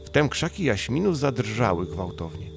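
usual speaking voice

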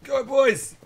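high, loud rallying cry; loud excited cheer. Real, not acted. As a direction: cheer the troops on hard. Cheering soldiers on.

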